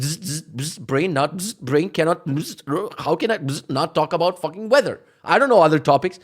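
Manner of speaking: glitching game character with buzzing stutters; frozen NPC driver. Pretend. NPC driver unable to avoid weather talk, mock glitching voice. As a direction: break words up with zaps like a crashed robot. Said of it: "they're just gonna get frozen".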